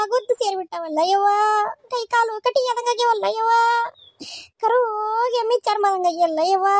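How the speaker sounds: high, tearful keening list; grieving lament. Acted. Mother grieves over her daughter's thin body, high and tearful. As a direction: mourn each hurt in a rising wail.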